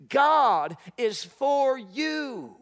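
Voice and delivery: loud, slow, drawn-out declaration of "God is for you"; proclaiming refrain. Acted. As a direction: ring out each word slowly. Climax of the sermon refrain.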